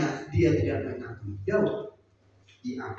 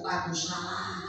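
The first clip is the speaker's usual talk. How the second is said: broken whisper-soft confession; 5 semitones higher, 8 dB quieter than usual. Acted. David weeping before God, softly giving in. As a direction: let Aku salah fall away quietly. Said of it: "berlutut menangis-nangis".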